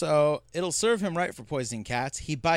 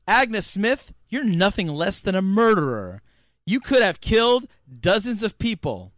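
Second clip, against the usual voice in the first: mock scolding; 3 semitones higher, 7 dB louder than usual. Quoting Rose scolding her sister.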